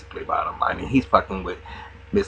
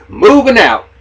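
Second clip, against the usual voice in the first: mock sarcastic toast; 11 semitones higher, 13 dB louder, slower than usual. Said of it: "and A was like".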